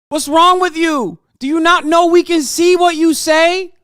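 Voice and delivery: loud high scolding; exasperated yelling. Real, not acted. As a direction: yell at them in disbelief. Streamer berating chatter.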